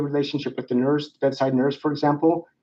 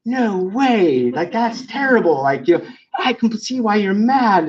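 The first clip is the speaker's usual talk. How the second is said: higher, animated sympathy; matching high energy; 8 semitones higher than usual. Shown as a demonstration. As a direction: meet their energy with eager agreement. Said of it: "kind of high energy and kind of fast".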